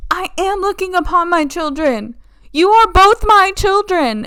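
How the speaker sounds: hammed-up grief; mock anguished wail. Countess crying out at both her children, hammed-up wail.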